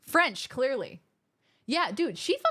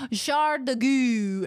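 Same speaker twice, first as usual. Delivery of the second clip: mock French; 2 semitones higher, 4 dB louder, much slower than usual. Mock French phrase, slowed.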